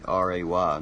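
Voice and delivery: ordinary delivery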